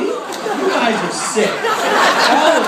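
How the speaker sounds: high, slow mock outrage; mock scolding. Real, not acted. Comic scolding the laughing audience, high and slow. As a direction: scold them slowly, pretending shock. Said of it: "scolding the laughing audience".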